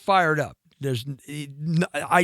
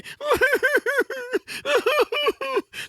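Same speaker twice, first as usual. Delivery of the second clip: a high, squealing laugh. Real, not acted. Actor cracking up at himself, high laugh. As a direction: burst into a high-pitched laugh. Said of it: "whining like a little boy".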